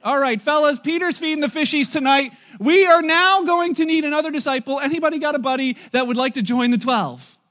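hammed-up boss calling out to the boys; mock announcer. Jesus mock-announcing Peter is out.

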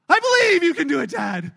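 big high shout that drops on the last word; mock kid's yell. Son jumping to dad, hammed-up loud yell. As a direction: holler it out like a kid leaping. Son finally jumps, yelling.

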